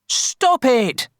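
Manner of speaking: put-on high storybook boy's shout. A narrator's reading. Kipper protesting to the villagers, shouting. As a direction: cry out in protest. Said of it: "shouted Kipper".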